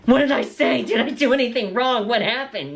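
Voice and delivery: hammed-up worried mom, high voice; freaked-out mom. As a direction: imitate mom in a mild mock panic. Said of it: "my mom absolutely freaked out".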